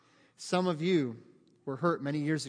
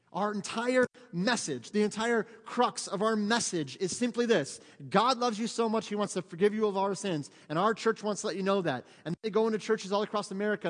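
preaching at full force; 6 semitones higher, 6 dB louder than usual. Acted. Pastor to congregation, proclaiming God's love at full force. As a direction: rise up and preach the heart of the message.

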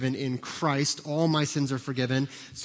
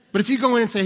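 normal talking voice

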